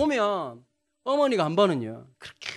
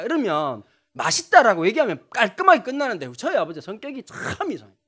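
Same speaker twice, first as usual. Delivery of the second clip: ordinary delivery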